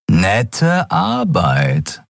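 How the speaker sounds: very slow, admiring; mild appreciative wonder; impressed. Acted. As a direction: let it out slowly, taken aback. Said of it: "Riur blinzelte beeindruckt".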